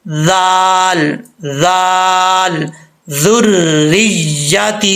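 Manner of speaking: slow, drawn-out Quranic recitation of जाल and ज़ुर्रिय्यती; tajweed recitation. Shown as a demonstration. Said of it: "कैसे पढ़ना है?".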